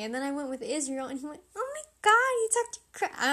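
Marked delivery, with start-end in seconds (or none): 1.6-3.2 high, loud gasping squeal of disbelief; excited squeal. Retold or imitated. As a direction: shriek it like a thrilled best friend. Friend squeals at crush moment.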